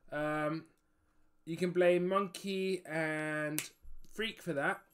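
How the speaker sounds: usual speaking voice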